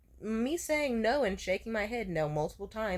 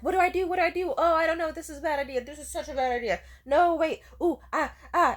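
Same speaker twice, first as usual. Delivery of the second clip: high, loud, flustered imitation; mock panicking; 8 semitones higher, 7 dB louder than usual. Imitating the date at the top of the hill, mock panic. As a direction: spiral into exaggerated dithering. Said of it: "Stop panicking".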